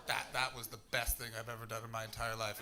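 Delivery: ordinary delivery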